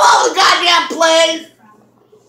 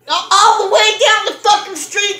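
ordinary delivery

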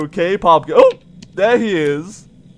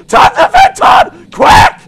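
high, loud panicked order; urgent shout; 7 dB louder than usual. Real, not acted. Player sees shadows in the vents, urgent shout. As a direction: yell it as an emergency command.

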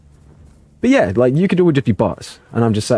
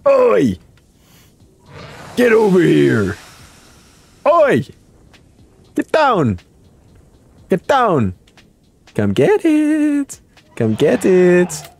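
high, drawn-out calling out; taunting call; 5 semitones higher, slower than usual. Pretend. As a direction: holler a playful challenge across the field. Taunts opponents in the game.

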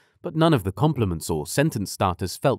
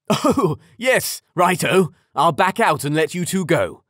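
high, loud flustered agreement; flustered dad voice; 8 semitones higher, 4 dB louder than usual. A narrator's reading. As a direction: bluster a quick agreement. Father flustered, backs out.

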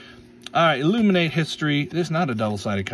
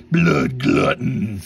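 slow, louder, drawn-out voice; silly monster voice; 4 semitones lower, 5 dB louder, much slower than usual. Pretend. Card opener reading a favourite name in his funny monster voice. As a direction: Blood Glutton dragged out in a put-on monster voice. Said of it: "I'm always gonna say it like that".

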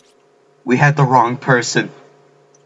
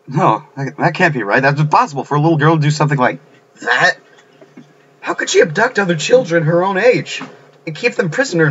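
incredulous protest; 6 semitones higher than usual. Acted. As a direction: voice climbing high in disbelief. Acted disbelief at the revelation.

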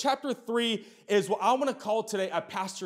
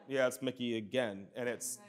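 mock unimpressed sigh of repetition; jaded; 8 semitones lower, 7 dB quieter than usual. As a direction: lower and much softer, bored drone. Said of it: "It's like".

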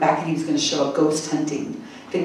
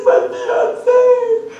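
mock sob. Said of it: "and she says".

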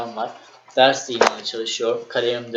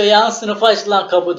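yüksek perdeden, yüksek sesle ve ağır; bağırma taklidi; 6 semitones higher, 6 dB louder, slower than usual. Retold or imitated. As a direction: arka sıradan sınıfa bağırır gibi. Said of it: "bas bas bağırıyor". (Uşaklı arkadaş arkadan bağırarak uyarıyor)

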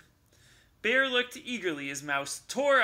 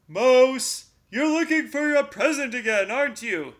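loud suspicious bear; knowing bear voice; 8 semitones higher, 10 dB louder than usual. A narrator's reading. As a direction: catch the mouse out.

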